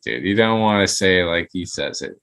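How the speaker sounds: usual speaking voice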